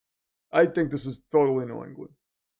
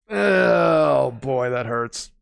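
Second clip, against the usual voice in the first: loud mock-pained oh, then a slow sag; hammed-up pain over a rival crying; mock anguished groan; 3 dB louder, much slower than usual. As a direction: ham up the heartbreak. Reacting to Josh Allen crying.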